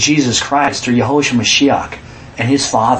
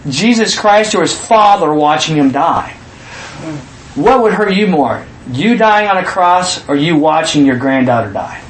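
ordinary talk, nothing marked

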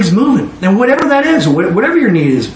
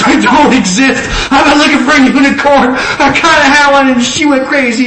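laughing reply; 8 semitones higher, 3 dB louder than usual. Retold or imitated. Retells his own laughing reply.

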